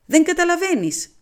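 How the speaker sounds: high, loud, impatient question; can't you see it?; exasperated. A narrator's reading. Poirot pressing his friend, high exasperated outburst.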